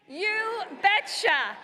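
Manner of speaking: loud, high, drawn-out mocking cheer; very slow, shouted crowd chant; Tom Tiffany catchphrase. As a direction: belt out a borrowed catchphrase, stretching both words. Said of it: "as Tom Tiffany would say".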